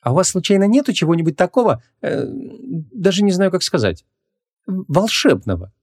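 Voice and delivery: high, halting, embarrassed question; awkward. A narrator's reading. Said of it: "неловко промямлила Вон".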